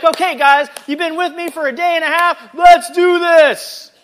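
rally the team like an over-keen coach; fired-up pep talk. Acted. Jesus hyping up the disciples after a weekend seminar, mock fired-up. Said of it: "he gets them all fired up".

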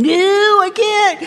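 high, hammed-up protest; mock whiny refusal. The person refuses the nagging thing, mock whining. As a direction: resist like a helpless whiner.

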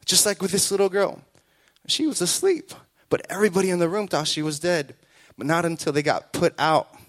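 normal talking voice

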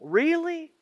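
high, rising, incredulous "really"; disappointed doubt. Acted. Jesus doubting a follower's words, disappointed. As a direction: stretch it upward in pained disbelief. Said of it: "that would be so painful".

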